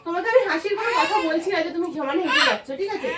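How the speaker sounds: high, loud scolding; angry yelling. Acted. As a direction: scold him for laughing. Fake quarrel, fighting laughter.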